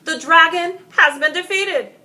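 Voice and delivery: loud, high proclamation of victory; a knight proclaiming victory to the crowd; triumphant announcement. Acted. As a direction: shout it to the whole crowd. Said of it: "and announced".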